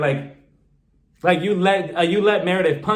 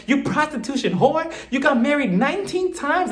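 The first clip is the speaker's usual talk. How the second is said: imagined Teresa outburst; 8 semitones higher than usual. Retold or imitated. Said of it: "waiting for her to be like".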